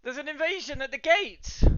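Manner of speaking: high, loud, urgent warning; alarmed barwoman. Acted. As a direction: cry out the news in a high voice.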